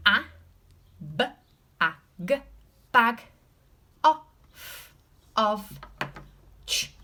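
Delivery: a b a g, of said sound by sound. Shown as a demonstration. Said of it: "So we can sound it out".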